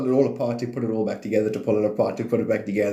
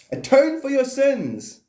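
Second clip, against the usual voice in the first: mock solemn decree; 4 dB louder, slower than usual. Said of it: "mock solemn decree".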